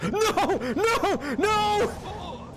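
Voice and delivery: high-pitched cries of no; panicked cry. Real, not acted. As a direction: yelp it as you plummet. Falling in game, cries out.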